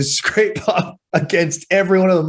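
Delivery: ordinary talk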